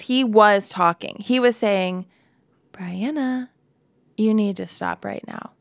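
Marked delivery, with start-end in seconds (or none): 2.7-5.5 soft, gentle inner voice; quietly, like a still small voice. Retold or imitated. Holy Spirit gently warning her to stop, softly. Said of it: "he's a still small voice".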